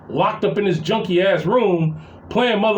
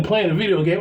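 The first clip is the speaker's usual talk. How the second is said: ordinary talk, nothing marked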